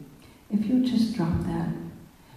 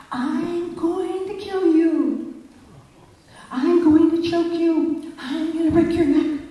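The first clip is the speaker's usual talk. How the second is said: retold screaming threat; 11 semitones higher than usual. Angry man threatening the speaker in the dark auditorium, shrill raging scream. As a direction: hurl the threats like a man out of his mind. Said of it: "he started screaming".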